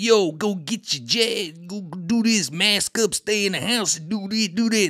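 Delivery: high, slow, hectoring; bossy Rappaport. Retold or imitated. Actor preaching jabs and masks to everyone, high and bossy.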